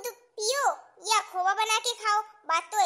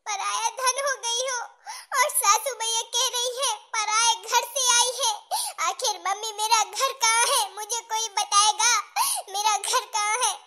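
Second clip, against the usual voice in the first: crying, anguished plea; wounded daughter wailing to her mother; tearful outburst. Acted. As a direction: break down and demand an answer through tears. Daughter breaks down on the phone.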